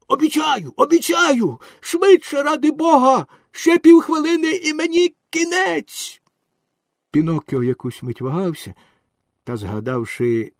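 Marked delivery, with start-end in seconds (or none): 0.1-6.2 very high, panicked shouting; desperate pleading. A narrator's reading. Drowning dog promises anything to be saved, frantic high cries. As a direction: cry out frantically, last word stretched.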